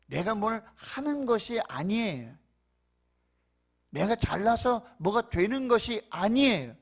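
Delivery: high, loud fervent preaching; preaching at full force. Real, not acted. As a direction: preach it out with rising fire. Preaching climax.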